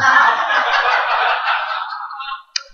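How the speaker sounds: breathy laugh at the punchline; real laugh. Real, not acted. Pastor laughing at his punchline, airy laugh. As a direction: laugh at your own joke.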